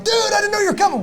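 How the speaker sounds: high, fast mocking imitation of a sheepish friend; mock buddy excuse. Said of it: "they're like".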